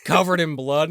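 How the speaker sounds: ordinary talk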